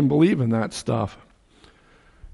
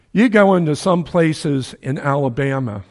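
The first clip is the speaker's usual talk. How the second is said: ordinary delivery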